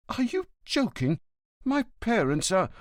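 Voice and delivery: hint of an offended son; indignant son. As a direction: protest in disbelief.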